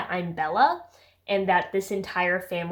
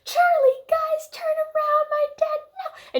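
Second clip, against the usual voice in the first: very high, strained hammed-up wail; exaggerated panicked squeal, drawn out; mock frantic flailing; 3 dB louder, slower than usual. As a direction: wail it like someone struggling to get free. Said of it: "flailing in the harness".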